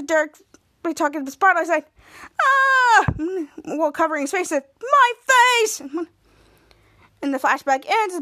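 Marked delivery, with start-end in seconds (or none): 2.4-3.0 high, loud "aah!"; drawn-out yell of mock pain; acted scream. Derek hit by the falling spotlight, high loud scream. 4.8-5.8 high, loud wail; acted anguished cry. Derek covering his hurt face, high loud cry.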